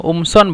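"উমসন" with a glottal start instead of qaf; Egyptian hamza. Shown as a demonstration. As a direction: begin "উমসন" straight on the vowel, no k. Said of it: "কফকে মিশিটা হামজা বলে".

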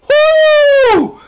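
very high, loud whooping shout; excited shout. Real, not acted. Fan cheering for a healthy Eli Manning, a loud high whoop. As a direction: yell woo like a fan in the stands. Hyping up healthy Giants offense.

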